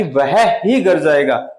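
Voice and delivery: slow, ही pressed; stress on ही. Shown as a demonstration. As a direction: insist on ही. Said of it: "जोर दे करके".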